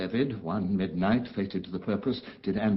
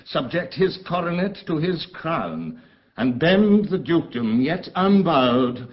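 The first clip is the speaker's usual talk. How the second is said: bitter outcry; 7 semitones higher, 6 dB louder than usual. Acted. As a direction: spit out the shame of it. Prospero's outrage at the betrayal.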